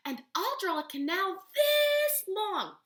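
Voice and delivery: put-on slow, high, loud kid stretching out the words; a boy bragging about his big canal; boasting kid. Boy in class boasting about his drawing, slow and loud.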